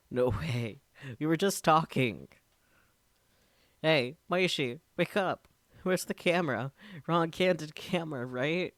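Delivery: loud, high panicked denial; frantic denial. Acted. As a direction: shout as if the prank must end now. Daru refuses to believe death.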